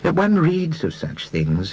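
a wistful sigh in a slow voice. Acted. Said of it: "said Mister Reader with a sigh".

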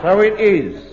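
put-on Cockney accent. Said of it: "I don't speak with a Cockney accent".

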